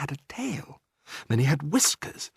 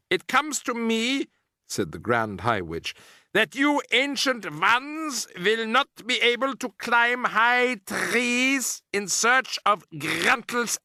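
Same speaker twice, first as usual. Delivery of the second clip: much higher, slow witch voice; menacing witch; 11 semitones higher, slower than usual. A narrator's reading. Grand High Witch mocking the ancient witches, menacing. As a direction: sneer it out slowly.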